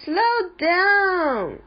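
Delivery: high storybook shout. A narrator's reading. Viv to Gran in the mountains, high alarmed shout. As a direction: cry out in alarm to slow the driver. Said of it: "shouted Viv".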